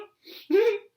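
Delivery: short, high-pitched jittery laugh; nervous laugh. A narrator's reading. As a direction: let out an uneasy little laugh. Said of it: "He laughed nervously".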